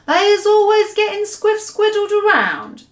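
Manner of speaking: a giant muddled by words; frustrated giant voice. Acted. BFG frustrated.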